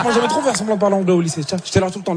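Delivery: usual speaking voice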